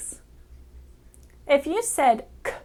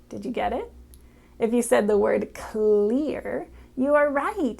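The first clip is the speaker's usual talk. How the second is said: ordinary talk, nothing marked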